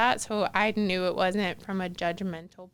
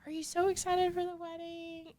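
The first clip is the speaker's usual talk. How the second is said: high, soft imitation of a kind friend; sweet friend's voice; 10 semitones higher, 8 dB quieter than usual. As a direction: lightly voice her high and gentle question. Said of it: "she said".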